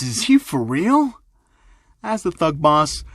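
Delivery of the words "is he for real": slow, high-pitched incredulous question; baffled disbelief. Acted. First thug asks his boss about the kid, baffled. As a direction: stretch it out, rising in disbelief.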